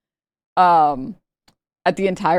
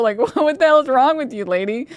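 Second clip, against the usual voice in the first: ordinary talk, nothing marked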